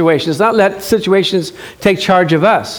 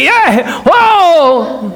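mock excited; 8 semitones higher, 3 dB louder than usual. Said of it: "I'd be like".

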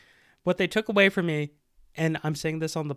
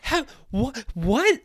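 higher, baffled and tripping over words; bewildered disbelief; 6 semitones higher than usual. Retold or imitated. Retelling crying at Wreck-It Ralph, baffled disbelief. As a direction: act lost at an emotional movie scene. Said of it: "I was like".

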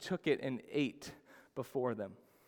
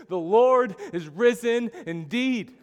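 breathless blurt; 7 semitones higher, 9 dB louder, slower than usual. Retold or imitated. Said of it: "they're all out of breath".

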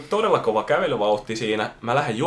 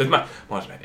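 ordinary talk

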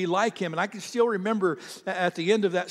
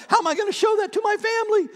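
high, fast panicked thought; a man panicking over what to tell his family; panicked inner voice; 6 dB louder, faster than usual. Retold or imitated. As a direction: blurt it out in a sudden panic.

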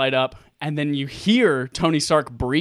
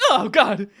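higher, louder awed gasp; thrilled as the armor locks on; awed exclamation; 7 semitones higher, 5 dB louder than usual. Retold or imitated. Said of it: "and I go".

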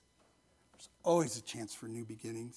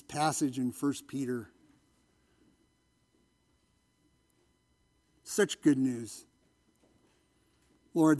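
pastoral prayer; 4 dB louder than usual. Acted. Pastor praying over his congregation, earnest and steady.

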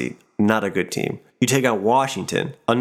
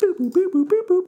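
toy robot voice. Pretend. Robot computing the answer.

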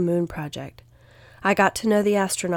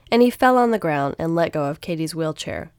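ordinary delivery

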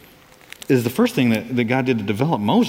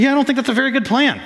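higher, fast, breezy brush-off; dismissive Moses; 9 semitones higher, faster than usual. Retold or imitated. Moses turning down God's plan, breezy mock refusal. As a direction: toss the plan aside casually. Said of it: "He said".

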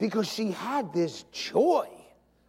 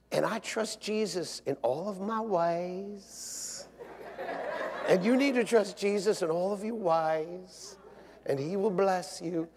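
slow, drawn-out Southern testimony; Southern accent; 4 dB quieter than usual. Retold or imitated. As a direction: stretch the word endings, amateur Southern accent. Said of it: "That's my best Southern accent".

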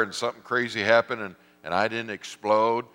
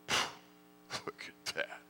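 smug whisper; 11 dB quieter, much slower than usual. Retold or imitated. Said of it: "And you're like".

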